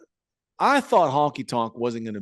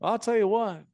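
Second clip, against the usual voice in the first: high put-on Honky voice; Honky Tonk Man; 9 semitones higher than usual. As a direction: imitate the old wrestler's zinger. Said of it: "He goes".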